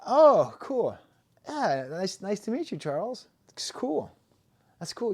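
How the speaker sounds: high, over-friendly greeting voice; acted small talk. Greeting a new guy who is also called [name], over-friendly. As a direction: put on high-pitched, eager politeness. Acting out meeting a newcomer.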